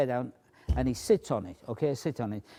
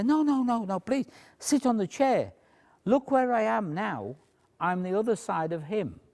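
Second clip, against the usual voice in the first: talking out loud; 9 semitones higher than usual. Shown as a demonstration. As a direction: project it high for the whole room. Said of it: "I'm talking out loud".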